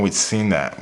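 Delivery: ordinary talk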